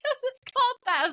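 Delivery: high, squeezed mock-crying voice; imitating tearful laughter. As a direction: squeak it out while trying not to crack up.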